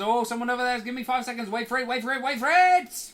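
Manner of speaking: fast, climbing into a shout; speeding up to reveal. Pretend. Texture pop-in betting countdown.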